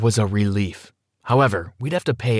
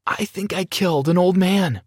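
mild narrated scream; 8 semitones higher than usual. A narrator's reading. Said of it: "I screamed".

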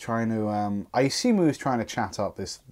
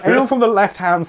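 laughing; 10 semitones higher, 7 dB louder than usual. Real, not acted. Laughing rant about time.

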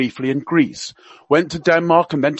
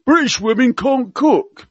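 higher, hammed-up Cockney accent; Prince Philip in Cockney; 8 semitones higher, 4 dB louder than usual. Radio guest quoting royal gaffes to listeners, mock Cockney accent. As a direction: play the old duke's gaffes in mock Cockney. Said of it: "he spoke with a Cockney accent".

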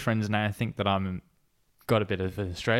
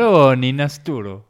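loud, hammed-up Italian; Italian accent; 7 dB louder than usual. Shown as a demonstration. Saying the other beer on request, Italian accent. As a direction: belt it out in mock Italian. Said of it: "Excellent at Italian accent".

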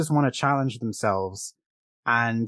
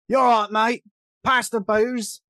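loud, high matey British caricature; 9 semitones higher, 4 dB louder, slower than usual. Acted. Mimicking the other accent in American films, rough British mate. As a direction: bellow it like a rowdy movie Brit, high-pitched. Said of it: "only two accents that American films tend to use".

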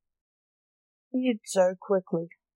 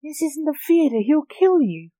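higher-pitched anxious warning; mildly nervous; 6 semitones higher, 3 dB louder than usual. A narrator's reading. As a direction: warn him with a touch of alarm. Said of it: "said Mary nervously".

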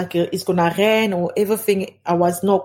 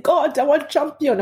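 retold screaming; 7 semitones higher than usual. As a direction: imitate a desperate scream at talking volume. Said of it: "I was screaming".

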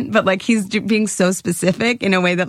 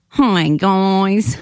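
put-on North Dakota accent with long round vowels; hammed-up North Dakota drawn-out vowels. Shown as a demonstration. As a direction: greet like an exaggerated North Dakotan. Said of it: "is pretty like".

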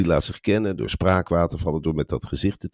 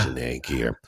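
ordinary talk

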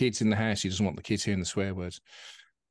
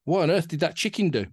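higher, louder, lightly acted alarmed parrot; a hint of a shocked parrot, pitched up; parrot's punchline; 6 semitones higher, 5 dB louder than usual. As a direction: land the joke as a startled parrot. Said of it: "And the parrot says".

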